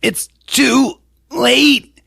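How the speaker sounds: very slow, high put-on cry; mock attacker. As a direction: stretch each word out dramatically. Acting attacker in horror scene.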